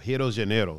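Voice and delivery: "Rio de Janeiro" with an H-like R in Rio; Brazilian pronunciation. Shown as a demonstration. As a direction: say "Rio de Janeiro" as HEE-oo jee zhuh-NAY-ro. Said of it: "That's how we say it in".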